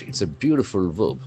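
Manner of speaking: usual speaking voice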